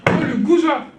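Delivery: betrayed wife exploding; outraged cry. Acted. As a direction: burst out in outrage. Hera learns of Zeus's affair.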